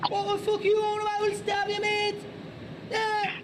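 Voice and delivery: very high-pitched, threatening Scouser; exaggerated Scouse. Shown as a demonstration. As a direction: squeal out menacing Liverpool slang. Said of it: "So here's, I think, a Scouser".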